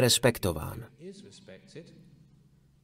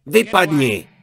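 loud, higher-pitched shout of an acted burglar; robber's roar; 6 semitones higher, 6 dB louder than usual. Thief caught at the cashbox yells at a monk, roaring. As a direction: bellow it like a startled thief chasing someone off. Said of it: "zařval na něj".

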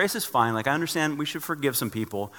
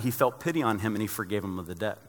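ordinary talk

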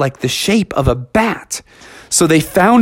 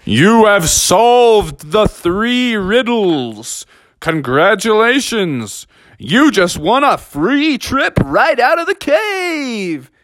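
slow, higher announcer boom; 7 semitones higher, slower than usual. A narrator's reading. The cave voice congratulates the friends, slow booming announcer. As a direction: proclaim the winners like a game-show host. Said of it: "the voice boomed".

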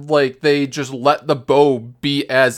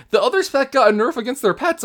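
usual speaking voice

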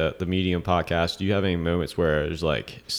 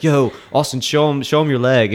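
excited; 8 semitones higher, 8 dB louder than usual. Retold or imitated. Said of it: "He's like".

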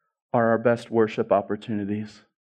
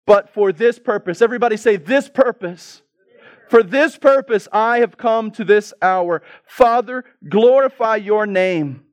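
high, loud declaration; impassioned preaching; 9 semitones higher, 6 dB louder than usual. Acted. Preacher has everybody say the purpose, impassioned preaching. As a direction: proclaim it forcefully to the congregation.

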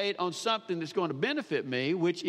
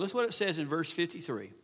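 ordinary talk

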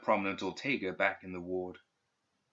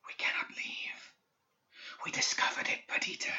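ghostly whisper; 6 dB quieter than usual. A narrator's reading. Dead student's voice reaching Perdita's mind, ghostly whisper. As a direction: breathe it like a distant ghost. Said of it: "Its whispering grew faint".

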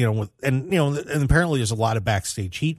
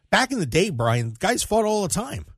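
excited point; 9 semitones higher than usual. Real, not acted. Host insists to co-host that feuds sell. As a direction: voice jumps up in excitement.

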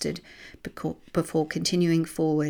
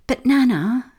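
protesting; 8 semitones higher, 9 dB louder than usual. A narrator's reading. Child protesting grandmother.

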